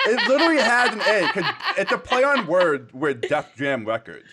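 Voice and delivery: loud, high, laughing exasperation. Real, not acted. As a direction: laugh out the punchline. Laughing while retelling.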